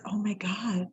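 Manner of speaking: hushed, amazed, barely voiced; awed whisper. New mother seeing her baby for the first time, awed whisper. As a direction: breathe it out in a stunned whisper. First look at newborn daughter.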